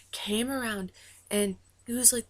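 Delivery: ordinary delivery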